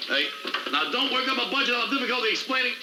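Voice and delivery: high-pitched parting caution; urgent, high-pitched admonishment. Acted. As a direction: call out a warning, pitch way up. Boss warns him as he leaves.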